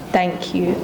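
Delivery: ordinary delivery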